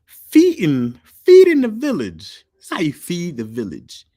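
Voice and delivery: high, loud, slow hype call; loud excited shout-out; hyped shout. Real, not acted. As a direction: hype him up like a crowd.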